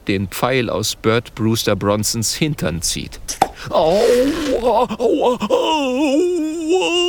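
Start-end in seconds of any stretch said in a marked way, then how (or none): 3.7-7.0 bellowing in pain. A narrator's reading. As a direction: howl as the arrow comes out. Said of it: "brüllt der Trapper".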